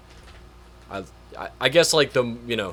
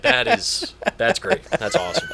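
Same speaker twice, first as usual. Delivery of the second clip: laughing delight; 9 semitones higher than usual. Retold or imitated. Recalling laughing at the joke.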